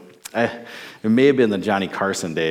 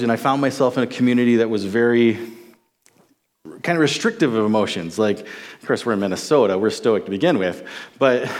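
ordinary delivery

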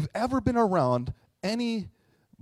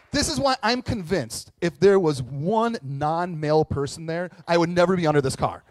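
ordinary talk

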